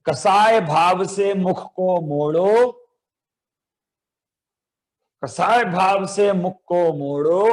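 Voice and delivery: slow, drawn-out chanted recitation of a poem line. Shown as a demonstration. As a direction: recite it slowly for children to echo. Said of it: "फिर ऐसे". (धीमा, खिंचा हुआ पाठ)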